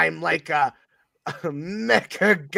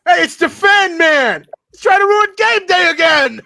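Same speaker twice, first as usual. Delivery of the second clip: yelling crowd; 12 semitones higher, 11 dB louder than usual. Pretend. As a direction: shout across the stadium at the fan man. Crowd yelling at fan man.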